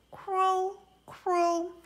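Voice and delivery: bird call. Acted. Dove call repeated.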